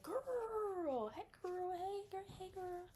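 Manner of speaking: very high gushing invite; excited friend. Retold or imitated. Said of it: "So you could be like".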